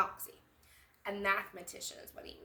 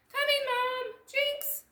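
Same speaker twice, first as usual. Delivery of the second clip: high, loud eager reply; girls answering together; 7 dB louder, slower than usual. Acted. The girls answering Mom together, gleeful. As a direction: sing out coming then jinx.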